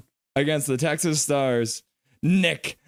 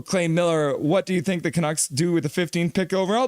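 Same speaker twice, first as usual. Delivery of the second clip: normal talking voice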